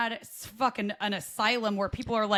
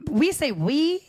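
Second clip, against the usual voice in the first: put-on Scottish accent; 4 semitones higher, 6 dB louder, much slower than usual. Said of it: "in a Scottish accent".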